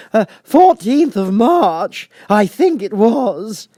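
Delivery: high, loud, slow fluttery Hatter; the Hatter apologizing to the king. Acted. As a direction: play the flustered Hatter.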